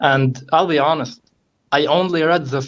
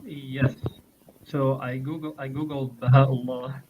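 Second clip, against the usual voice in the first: very faint, far from the mic; too quiet to hear; barely audible; 7 dB quieter than usual. Real, not acted. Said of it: "I think your voice is very low".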